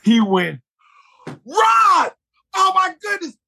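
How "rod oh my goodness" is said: very high, ecstatic cry of recognition; overjoyed. Retold or imitated. Actor suddenly recognizing his old Kinko's friend, overjoyed. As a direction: burst out high, acting thrilled. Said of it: "he was so happy for me".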